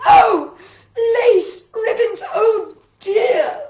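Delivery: very loud, high, slow cry; shocked wail. Acted. Missus Rogers finding the lace-covered steak, loud wail. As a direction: wail in half-laughing dismay.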